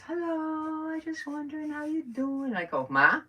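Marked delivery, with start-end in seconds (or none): 0.1-2.5 high, drawn-out, singsong mother impression; so sweet. Mother calls daughter by accident, imitated sweet high voice. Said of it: "she sounded so sweet". 2.9-3.2 high, loud mock puzzlement; mother's puzzled question. Mother doesn't recognize her daughter, high puzzled voice. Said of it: "And she goes".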